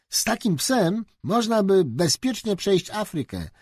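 put-on high, keen boyish cry; a boy dreaming of crossing Africa, eager; eager exclamation. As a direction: call it out full of adventure. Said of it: "zawołał Staś".

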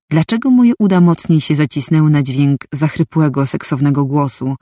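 ordinary delivery

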